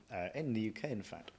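ordinary delivery

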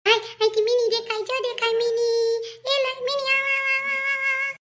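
put-on high sing-song child's call; child calling the cat. Child calling for the cat to come, high sing-song. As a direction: call "mini" high, stretching into held notes.

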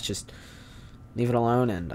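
ordinary talk

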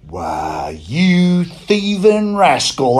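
slow, stretched-out cry of outrage; angry yell. A narrator's reading. Boy yelling at the monkey who took his apples, angry yell. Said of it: "I yelled".

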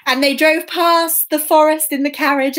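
ordinary delivery

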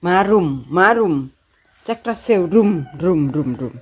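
low gentle coaxing to pets. Real, not acted. Low cooing to dogs.

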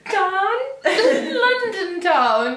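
very high, drawn-out English "town"; mock English accent. Teasing the London town attempt, high mock English accent. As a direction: mimic a bad English accent, laughing.

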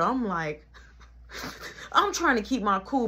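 ordinary delivery

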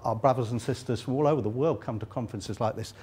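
ordinary talk

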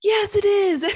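put-on high, loud childish retort; childish insistence. Daughter retorting to her mom that dad is to blame, high and loud. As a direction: shoot back like a stubborn kid. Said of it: "And I'm like".

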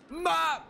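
high, short scream; abrupt high scare cry; startled yelp. Real, not acted. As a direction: yelp in sudden fright. Jump scare at the door.

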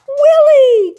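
an overjoyed loud cry; joyful cry. A narrator's reading. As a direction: cry out the friend's name with joy. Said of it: "cried Alexander".

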